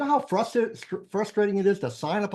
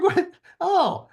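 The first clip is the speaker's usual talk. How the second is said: surprised; 6 dB louder than usual. Retold or imitated. Retold coach reacting surprised.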